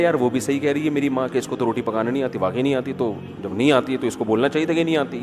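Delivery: low, soft, quick reasoning; hapless husband. Acted. Husband siding with his mother, low and reasonable. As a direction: imitate a husband mildly weighing both sides. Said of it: "وہ کہہ رہا ہے".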